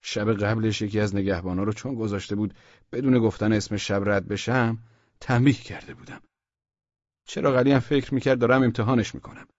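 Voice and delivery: ordinary delivery, nothing marked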